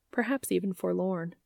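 ordinary delivery

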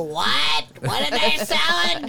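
high questioning cartoon voice; lightly voiced cartoon girl; imitating a cartoon daughter. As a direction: ask it like a puzzled cartoon kid. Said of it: "like, so".

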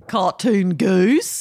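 slow Australian cartoon-goose voice; cartoon-goose Australian accent. Shown as a demonstration. Co-host introduces their best accent, cartoon-goose Australian. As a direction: stretch it out in comic Australian. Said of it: "my best Australian accent is".

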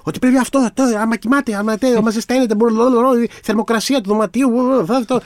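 high-pitched stumbling barrage of advice; imitating yourself bombarding someone with tips; retold rapid-fire barrage. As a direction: reel off instructions in a high, babbling rush. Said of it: "άρχισα εγώ να τον πυροβολάω".

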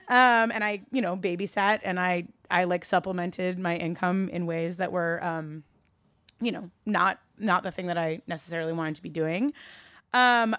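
ordinary talk

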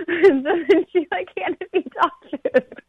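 laughing while telling. Real, not acted. Recounting the dream to her listener, talking through laughter. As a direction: let the laugh carry the words.

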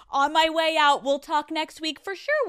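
high, breezy parting promise; mock therapist already heading out; imitated uncaring therapist. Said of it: "doesn't give a fuck".

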